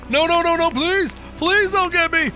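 high, loud panicked pleading; terrified begging. Real, not acted. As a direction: cry out to be spared. Chased by Siren Cop.

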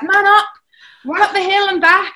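high, loud bossy stepdad barking orders; mock coach yell. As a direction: shout him up the hill like a drill coach. Stepdad yelling during training.

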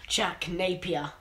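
ordinary talk, nothing marked

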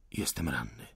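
low, soft reply; weak wounded answer. A narrator's reading. Wounded insurgent answers the officer, low and weak. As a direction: answer faintly, as if hurt.

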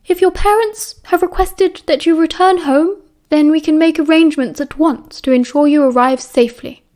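a worn-out teacher breaking bad news; strained, tired professor. A narrator's reading. McGonagall breaking bad news to students, high thin strain. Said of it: "her voice thinner than usual, strained and tired".

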